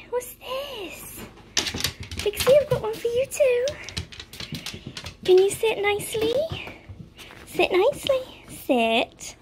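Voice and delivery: high, sing-song voice to the dogs. Real, not acted. Owner coos at her dogs over treats, high and sing-song. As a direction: coo at the pups to get them excited.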